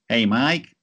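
a light, breezy New York greeting; lightly voiced friendly American boss saying hi; David impression. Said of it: "that is my David impersonation".

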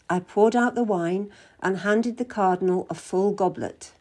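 usual speaking voice

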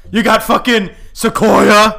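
loud hype-man bellow; shouted hype call. Pretend. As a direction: yell it like a wrestling promo. Bit with "What?" call-and-response.

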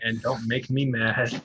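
slow gruff toy voice line; like a talking toy catchphrase; gruff tinny action figure. Retold or imitated. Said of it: "as his action figures used to say".

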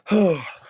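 loud, high, pained sigh; heavy emotional sigh. Real, not acted. Overcome on air, a loud heartbroken sigh. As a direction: let it all out in one aching sigh. Emotional break mid-talk.

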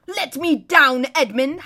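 loud, high, drawn-out howl; indignant howl. A narrator's reading. Prince hung on a hook before laughing enemies, howling. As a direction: howl it in humiliated outrage. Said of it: "howled Rapidash".

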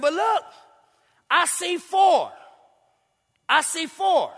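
high, loud amazed cry; astonished king. Acted. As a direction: gasp it out in wonder. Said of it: "He said".

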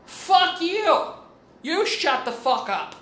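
loud, high, furious yell back; retold crazy yell. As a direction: re-enact losing it and screaming at a neighbor. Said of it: "the crazy in me comes out and I yell".

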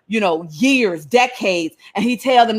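normal talking voice

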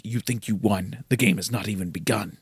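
low, soft menacing villain. Retold or imitated. Hendrik gloating at escaping heroes, low menace. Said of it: "Hendrik's like".

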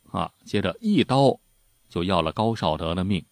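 ordinary delivery

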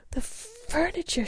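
high, slow, loud cry of dismay; horrified cry. A narrator's reading. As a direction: cry out in shock, drawing it out. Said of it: "she cried".

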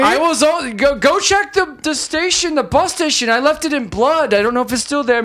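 high-pitched comic confession; hammed-up, high-voiced tattling; mock killer confession. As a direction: babble helpfully as the culprit, pitched high. Riffs as killer leaving clues.

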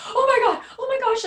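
high loud dismayed oh my God; mother shocked at her son's news. Retold or imitated. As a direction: gasp it out in shock. Mom hears the bad news.

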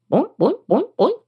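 springy rising "boink"s; boinking hop sounds. A narrator's reading. Frog hops in after princess, springy boinks. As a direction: bounce each noise upward like a hop.

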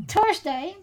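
usual speaking voice

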